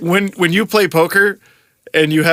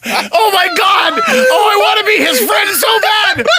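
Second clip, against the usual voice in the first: laughing shout; slower than usual. Real, not acted. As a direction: shriek it through helpless laughter. Cracking up at the joke.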